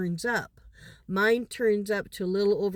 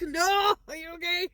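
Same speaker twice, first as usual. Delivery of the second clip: higher, alarmed check-in; worried; 6 semitones higher than usual. Retold or imitated. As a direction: ask urgently if she is alright. Grandma grabbing panicking granddaughter.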